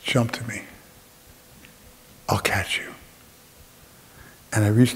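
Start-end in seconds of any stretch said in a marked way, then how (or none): 0.0-2.9 a hint of a gentle dad, nearly whispered at the end; softly voiced father. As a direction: lightly play a reassuring father, fading soft. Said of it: "and he's saying".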